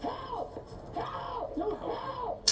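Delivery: high-pitched cries for help; yelling for help. Real, not acted. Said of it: "why are you yelling like a little".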